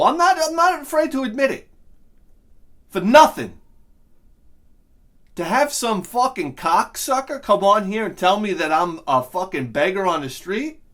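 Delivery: loud, high, outraged yelling; furious shouting. Real, not acted. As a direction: tear into the commenter. Furious rant at commenter.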